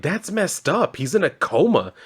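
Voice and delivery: high-pitched, appalled objection; mock indignation. Friends scolding a man about their comatose pal, indignant. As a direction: protest it in mock offence. Characters object to a crude joke.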